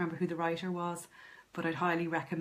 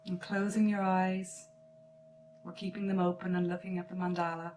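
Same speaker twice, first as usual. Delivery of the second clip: slow meditation voice with long pauses; guided meditation; 3 semitones higher than usual. Acted. Artist leads listeners into noticing their breath, guided meditation. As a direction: guide them gently into relaxation, phrase by phrase.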